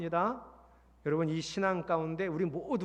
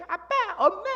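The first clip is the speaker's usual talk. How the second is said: put-on child calling; 12 semitones higher, 7 dB louder than usual.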